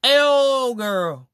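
put-on high, loud disbelief at "A whole girl"; high incredulous drawl. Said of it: "I'm sitting there like".

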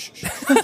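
two short hushing sounds; quick double "shh"; soothing shush. Acted. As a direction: shush someone to calm them. Hushing someone revived from faint.

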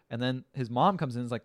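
normal talking voice

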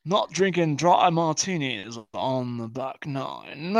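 slow, snooty golf-club voice; hammed-up toff on the golf course; mock posh drawl. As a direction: drawl it like a country-club snob.

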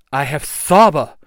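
loud, emphatic weapon call; proclaiming yell. A narrator's reading. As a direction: shout it as a revelation.

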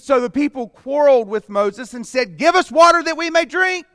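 loud, high, demanding cry; the people demand water from Moses, loud and high; demanding crowd. Acted. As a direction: shout it like a thirsty mob. Reading the people's demand aloud.

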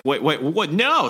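hammed-up panicked protest; mock alarm. Lash freaking out before shooting, rising high cry.

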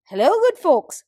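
high, loud bright hello; cheery goose greeting. Acted. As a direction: call out like a friendly goose.

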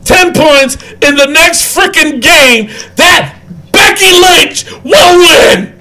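high, slow, emphatic declaration; emphatic bet. Real, not acted. Host staking ten points on Becky Lynch, emphatic challenge. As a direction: raise the voice and punch out the wager.

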